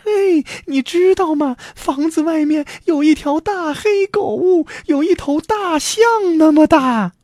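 very high, alarmed wife voice; frightened cry. A narrator's reading. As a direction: call out the danger in a high wail. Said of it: "喊道".

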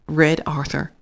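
plain reading voice

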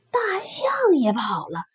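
usual speaking voice

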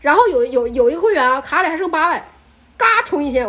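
ordinary talk, nothing marked

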